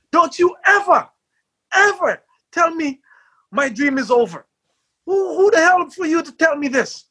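high, yelled outburst at the reporter; furious shout. Retold or imitated. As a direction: blow up at him, voice cracking high. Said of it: "I got so pissed".

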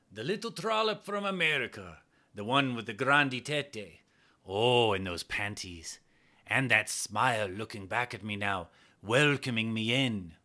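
a hint of a leering Italian commander; lightly voiced, pleased with himself; Italian man.

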